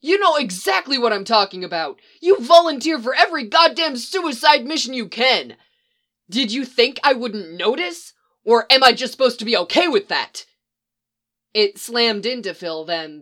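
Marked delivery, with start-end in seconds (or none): 0.0-10.5 angry accusation. Acted.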